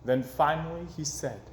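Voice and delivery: ordinary delivery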